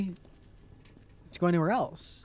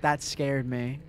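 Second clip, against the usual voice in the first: normal talking voice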